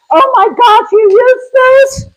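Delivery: mock gasp of disbelief, high up; surprised subscriber. A subscriber amazed she uses them, high mock surprise. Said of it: "I had a subscriber say to me".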